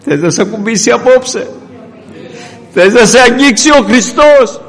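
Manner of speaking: very high, very loud altar call; shouted revival invitation; climactic preaching. Acted. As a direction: sweep the congregation up at the peak.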